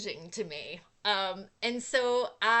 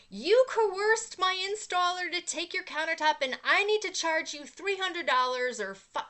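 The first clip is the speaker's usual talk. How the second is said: raised, louder voice of a livid caller; imitated angry yelling; 7 semitones higher, 6 dB louder than usual. Company owner phones to demand money for countertops, imitated angry yelling. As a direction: mimic an irate boss yelling, higher and louder. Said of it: "he starts yelling at me".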